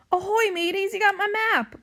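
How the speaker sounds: high, loud character voice; pirate hail. A narrator's reading. Toad greeting pirates on the beach, high loud hail. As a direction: hail the crew like an old shipmate.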